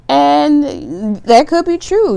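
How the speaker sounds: high and slow, still laughing through it. Real, not acted. Reader still giggling after cussing, laughing speech. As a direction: talk while the laugh fades.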